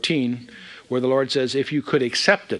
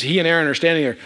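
ordinary delivery

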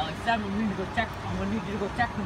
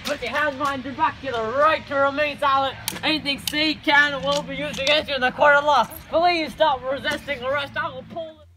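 high, raised, forceful voice; commanding arrest; 6 semitones higher, 5 dB louder than usual. Acted. Officer arresting a resisting murder suspect, raised commanding voice. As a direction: call out the rights over a struggle.

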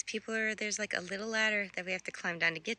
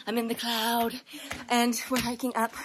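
ordinary talk, nothing marked

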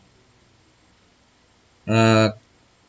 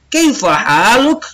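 put-on bright, higher boyish question, slow and lilting; Ali asking; 9 semitones higher than usual. Ali greeting Sulaiman, lightly voiced high friendly question. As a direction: play young Ali asking how his friend is.

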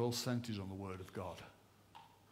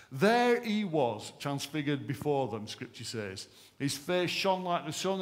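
louder, higher, building scripture reading; dramatic scripture reading; 5 semitones higher, 6 dB louder, slower than usual. Acted. Preacher reading the Transfiguration aloud, dramatic. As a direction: proclaim the scripture with awe.

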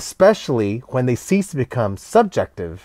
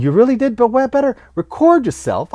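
playful teacher; 11 semitones higher, 4 dB louder than usual. Retold or imitated. Teacher's playful reply to student.

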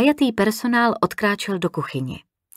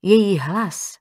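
usual speaking voice

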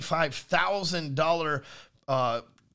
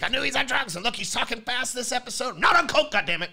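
higher, louder jeering voice; hammed-up internet accuser; mock accusing viewers; 7 semitones higher, 5 dB louder than usual. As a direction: mimic the commenters shouting gotcha. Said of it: "y'all always like".

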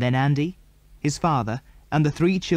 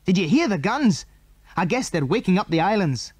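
excited; 6 semitones higher than usual. Acted. Andy excited about the guns.

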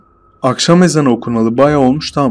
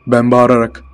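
ordinary delivery

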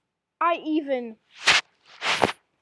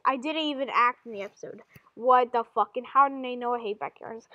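ordinary delivery

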